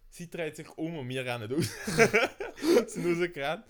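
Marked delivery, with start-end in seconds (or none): 1.7-2.9 high loud laugh; real laughter; burst of laughter. Real, not acted. Laughing at running out.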